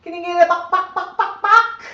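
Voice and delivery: high, loud sing-song buck buck; high squeaky coaxing to get the parrot to cluck; coaxing buck call. Shown as a demonstration. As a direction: call it up high to coax the bird. Coaxing parrot to make sound.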